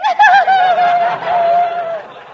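high, loud hammed-up laugh; big laugh. Woman laughing loudly off Costello's jab, high and loud. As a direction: throw out a big cackle at the insult.

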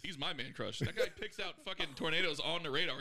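usual speaking voice

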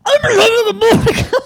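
high, loud raspy shout, laughing; raspy scream. Pretend. Asked for a Russian accent, she screams it raspy. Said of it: "I just became raspy".

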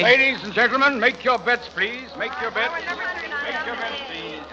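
sing-song croupier calling for bets. Acted. Croupier calling the table to place bets, sing-song.

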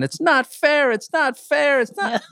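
put-on high, whining little boy voice; imitating a whiny kid. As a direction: whine it like a scared child. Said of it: "And he's going".

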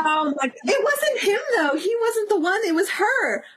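high-pitched, emphatic protest; indignant defence. Real, not acted. Fan defending celebrity against friend's blame, high indignant protest. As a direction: jump in high to clear his name.

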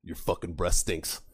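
low, slow instructor put-down; deadpan insult. Acted. Instructor stepping back from recruit, low deadpan insult. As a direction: deliver it deep and slow, word by word.

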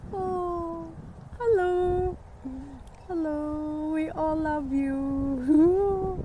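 high, slow cooing to a pet. Real, not acted. To the pet on the walk, slow high cooing. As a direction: melt over the little animal, drawn out.

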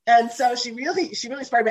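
usual speaking voice

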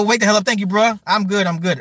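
usual speaking voice

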